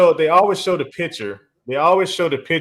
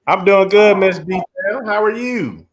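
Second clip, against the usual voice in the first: ordinary talk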